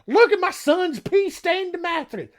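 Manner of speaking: high, slow mock voice of a shaming Italian mother; hammed-up mother showing off the mattress to the neighbours; mother sneering at her son in a high put-on voice. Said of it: "It's just like".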